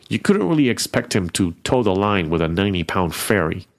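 usual speaking voice

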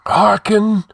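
high, ringing ghostly command. A narrator's reading. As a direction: summon attention like an ancient spirit. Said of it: "spoke the other commandingly".

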